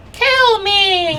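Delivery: very high, strained plea; dying Kenku. Acted. Wounded Kenku looks up at the victor, pleading shrilly. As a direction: squeal it out like a wounded creature. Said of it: "and he says".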